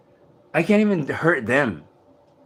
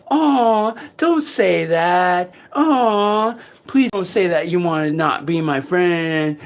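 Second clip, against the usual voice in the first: much higher, slow whiny plea; pleading voice; 7 semitones higher, slower than usual. Retold or imitated. Imitating the voice that claimed to be God, whiny pleading. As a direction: beg sweetly like a clingy friend. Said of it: "that voice that said it was God".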